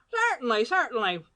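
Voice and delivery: high, bright-eyed fussy caller; pompous gentleman voice. Acted. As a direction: play the chatty intruding gent. Badger agreeing.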